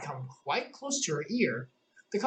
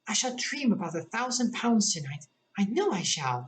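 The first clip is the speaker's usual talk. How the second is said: Alice voice; 6 semitones higher than usual. A narrator's reading. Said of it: "thought Alice".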